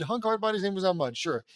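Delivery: quick run-through, falling at the end; resigned re-read. Real, not acted. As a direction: rattle it off and give up questioning. Re-reads it, giving in with "sure.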